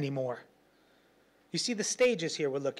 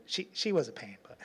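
ordinary talk

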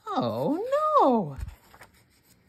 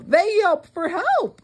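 high yelp; 12 semitones higher than usual. Acted.

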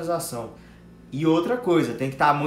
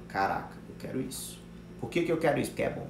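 imagined inner thought; 2 semitones lower, 7 dB quieter, faster than usual. Acted. Voicing the customer's inner thought.

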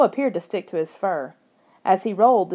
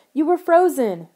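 high, loud, emphatic exclamation; excited telling; 8 semitones higher, 5 dB louder than usual. A narrator's reading. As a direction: tell a friend the astonishing news. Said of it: "she said".

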